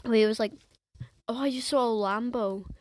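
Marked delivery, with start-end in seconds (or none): none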